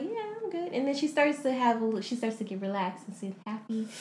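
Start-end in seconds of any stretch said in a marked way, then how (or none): 0.0-0.7 soft reply. Retold or imitated. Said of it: "And then she's like".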